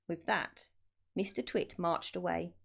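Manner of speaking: plain reading voice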